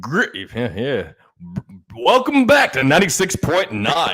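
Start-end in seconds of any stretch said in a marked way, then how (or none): 1.6-4.1 louder, higher radio announcer welcome. Acted. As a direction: sell it like a morning radio host. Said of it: "sounds like a radio voice".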